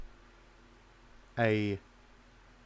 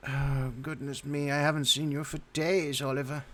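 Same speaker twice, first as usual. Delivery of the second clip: put-on creaky old woman; 5 semitones higher than usual. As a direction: quaver it like a frail granny. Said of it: "your voice came out really creaky".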